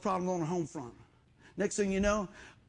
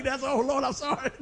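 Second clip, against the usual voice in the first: flustered apology; 7 semitones higher, 7 dB louder than usual. Retold or imitated. Pushing cart over baby's foot.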